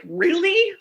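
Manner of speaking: high-pitched incredulous question; exasperated disbelief. Retold or imitated. Retells exasperation after her week.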